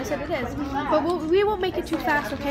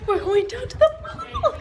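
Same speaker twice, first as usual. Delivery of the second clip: laughing; 11 semitones higher than usual. Real, not acted. Laughing while walking.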